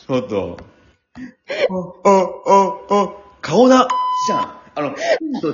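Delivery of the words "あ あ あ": usual speaking voice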